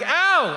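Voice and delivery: high, acted "ow"; mock yelp of pain. Patient squeezed really hard, high mock yelp. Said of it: "to the point where I'm like".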